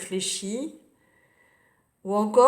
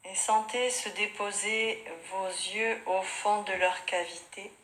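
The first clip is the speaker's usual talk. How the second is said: softer, unhurried yoga nidra guidance with long pauses; guided meditation; 4 dB quieter than usual. Real, not acted. Yoga teacher guiding students lying on their mats, calm meditation voice.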